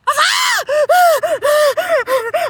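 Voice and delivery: big loud burst of laughter; loud shocked laugh. Real, not acted. Flame flew into her face.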